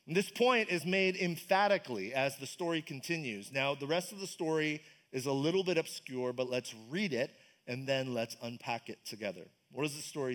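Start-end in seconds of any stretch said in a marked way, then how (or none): none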